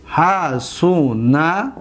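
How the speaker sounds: very slow, each syllable held. Shown as a demonstration. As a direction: stretch it out syllable by syllable. Second take broken into syllables.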